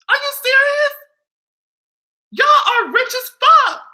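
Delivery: high-pitched mock outrage at the rich; high disbelief. Real, not acted. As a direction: shriek it out in disbelief.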